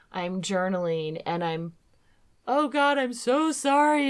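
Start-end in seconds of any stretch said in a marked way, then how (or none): 2.5-4.1 high, pleading apology; a guilty plea to God, pitched up; remorseful prayer. Retold or imitated. Retold prayer of guilty confession.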